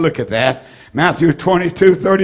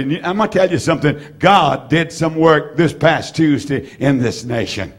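ordinary delivery

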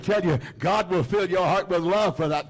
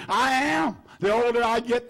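usual speaking voice

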